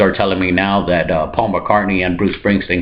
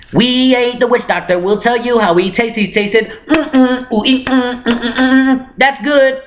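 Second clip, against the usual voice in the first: high cannibal voice; 13 semitones higher than usual. Retold or imitated. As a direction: sing out the tasting noises. Voicing cannibal cult members.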